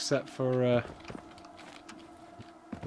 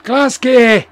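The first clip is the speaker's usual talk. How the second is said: big hammed-up shout of the city name; a high, loud fake Scottish cheer; loud mock shout; 10 semitones higher, 13 dB louder than usual. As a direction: bellow it like a rowdy fan.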